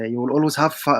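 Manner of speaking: ordinary delivery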